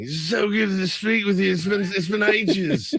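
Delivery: high, slurred mock British accent; hammed-up tipsy English greeting. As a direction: imitate a British rocker catching up. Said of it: "like, [name] on me".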